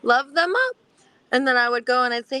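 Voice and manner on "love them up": high, bright sing-song advice; imitating the psychologist. Quoting her psychologist's stock reply in a high lilting voice. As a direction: lilt it up like a cheery therapist. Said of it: "And they would say".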